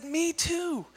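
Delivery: delighted squeal. Retold or imitated. Man delighted again.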